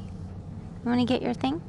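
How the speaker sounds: ordinary talk, nothing marked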